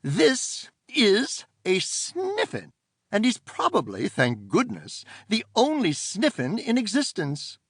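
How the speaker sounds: prim, scolding auntie; stern aunt voice. Acted. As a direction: order them out at once. Aunt explains creature.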